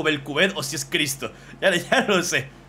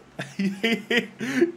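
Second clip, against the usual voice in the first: squeaky high laugh; short delighted laugh up high; high giggle; 9 semitones higher than usual. Real, not acted. Laughing at drawing.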